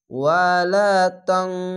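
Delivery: slow recitation, তান said nasal like ং; tajweed recitation. Shown as a demonstration. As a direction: recite ওয়ালা তানসুরুন্নাহ with a nasal তাং. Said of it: "আরেকটি বার পড়ছি".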